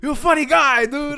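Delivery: high, loud mock complaint; reproachful worker. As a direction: imitate a rattled worker having a go. Shaken worker reproaches him.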